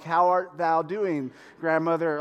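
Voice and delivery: ordinary delivery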